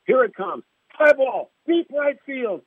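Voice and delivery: rising, high excited home run call; mock play-by-play call. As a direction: build the call to a peak on gone. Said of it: "He says".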